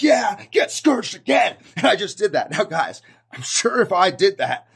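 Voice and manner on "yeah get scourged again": slow, high, loud pretend fury; hammed-up wrathful holler; mock-zealous shouting. Zealot pretend-scourging a doubter once more, shouting. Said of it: "I'm like".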